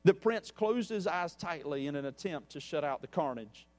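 measured storyteller reading a parable to the congregation; story narration. A narrator's reading. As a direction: tell the tale steadily, letting the quotes land. Reads a parable aloud.

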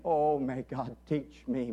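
normal talking voice

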